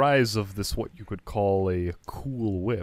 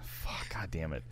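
whispered fuck under the breath; muttered curse; 10 dB quieter than usual. Real, not acted. Narrator cursing at himself after a flub, whispered. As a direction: hiss it out, barely voiced.

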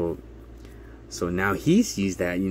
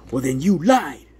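triumphant accusation; 6 semitones higher, 7 dB louder than usual. Retold or imitated. Attorney accuses caseworker.

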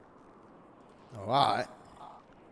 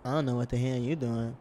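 usual speaking voice

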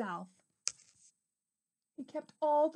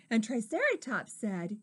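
ordinary delivery

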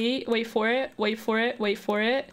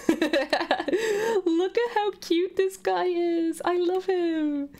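slow, high giggly gush; high giggling coo; laughing coo; 5 semitones higher, much slower than usual. Real, not acted. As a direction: coo through a laugh at the cute creature. Cooing at cute Pokemon.

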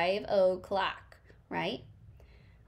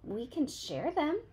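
higher, slower, kindly offer; friendly bug offers to share; friendly suggestion; 6 semitones higher, 3 dB quieter, slower than usual. A narrator's reading. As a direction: offer gently and sweetly. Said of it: "suggested the friendly ladybug".

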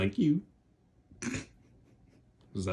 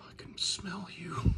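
much softer eerie hiss; creepy whisper; 4 semitones higher, 9 dB quieter than usual. Acted. Dog puppet whispering creepily to Damien. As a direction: whisper it menacingly.

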